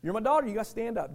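ordinary talk, nothing marked

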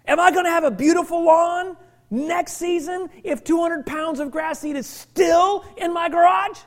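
exhorting preacher, building to emphatic shouts; full-force preaching. Real, not acted. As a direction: drive each point home to the congregation.

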